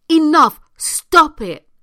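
loud, emphatic command; forceful shout. Real, not acted. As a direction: shout it out, firm and final. Urging listeners to stop being controlled.

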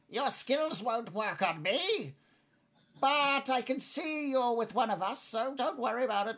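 high, drawn-out goblin voice. Acted. Goblin answering a failed charm attempt, high creature voice.

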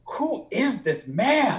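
high, drawn-out amazement; awestruck. Retold or imitated. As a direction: marvel slowly in disbelief. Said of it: "I didn't even have words".

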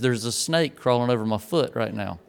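low, hushed and careful, don't startle the snake; hushed warning. Retold or imitated. Friend at the pond warning about the snake, hushed. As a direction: hold perfectly still and murmur it.

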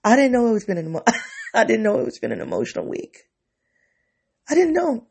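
high, fast, tearful with laughs; crying and laughing. Real, not acted. Surprised by own tears, laughing while crying. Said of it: "I'm irrationally crying right now".

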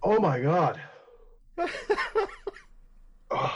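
ordinary talk, nothing marked

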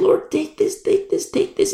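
breath-heavy repeated plea; acted desperate prayer; begging. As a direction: beg urgently, half-whispered. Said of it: "that thing that you're begging".

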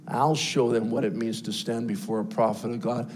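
a low, intimate sermon hush; hushed preaching. Acted. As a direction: pull the room in close and quiet. Preacher drops to hushed storytelling.

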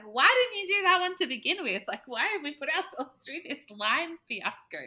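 high, loud, playful exasperation; mock exasperated husband. Husband scolding his wife over the test, playfully exasperated. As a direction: tease her for the wasted worry.

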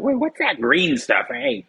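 high, startled squeak at moldy cheese; mock surprised voice. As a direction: play someone discovering mold.

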